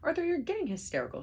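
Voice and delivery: high fast scolding; quick high reprimand; hurried scolding. A narrator's reading. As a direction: rattle it off sharply. Ford scolds Arthur.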